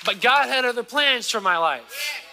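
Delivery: high, loud preacher voicing God; God speaking through the preacher; preaching. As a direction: lift the congregation as God's promise. Preaching God's plan.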